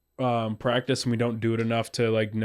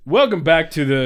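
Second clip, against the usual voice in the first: loud podcast intro; 5 semitones higher, 6 dB louder than usual. Acted. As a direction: hype announcer call. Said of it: "just say dude man duos".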